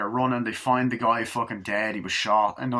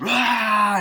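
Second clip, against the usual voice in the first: sharp shout, higher and louder; loud high yell; 10 semitones higher, 7 dB louder than usual. Retold or imitated. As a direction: burst out with a yell. Chucky jumps up.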